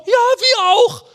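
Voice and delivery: high, loud, sing-song "me too"; mock the people's eager yes; preacher mocking the people, high and eager; eager crowd. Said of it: "dann sagt das Volk".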